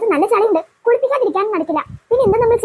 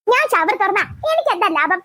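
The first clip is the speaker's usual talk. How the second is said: usual speaking voice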